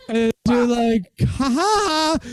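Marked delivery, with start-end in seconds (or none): 1.2-2.2 sarcastic fake laugh. Girlfriend's mock laugh.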